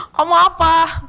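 very high, playful mock question; hammed-up teasing between friends; laughing banter. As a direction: pitch it way up, laughing as you ask. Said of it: "Karena ketawa-ketawa ngomongnya".

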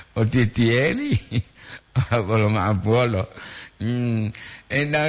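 ordinary talk, nothing marked

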